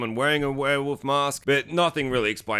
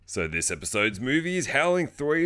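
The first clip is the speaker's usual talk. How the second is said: ordinary talk, nothing marked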